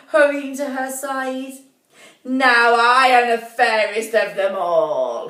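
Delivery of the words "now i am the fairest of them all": loud gloating witch voice; wicked witch gloat. A narrator's reading. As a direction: cackle it out triumphantly. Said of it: "cackled the old woman".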